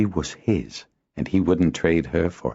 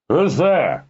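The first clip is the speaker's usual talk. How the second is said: high, sharp irritable challenge; 11 semitones higher, 4 dB louder than usual. A narrator's reading. Harper calls from his room, irritably. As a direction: demand it irritably from another room. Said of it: "Master Zist asked irritably".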